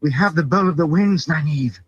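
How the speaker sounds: high-pitched, thrilled call; excited announcement. A narrator's reading. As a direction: share the find in high excitement. Thrilled finder announces the discovery.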